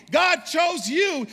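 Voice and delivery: high, loud and slow, each word hammered; shouted proclamation. Acted. Pastor declares it to the whole church, shouting slowly. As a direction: shout it over the crowd. Repeated climax line.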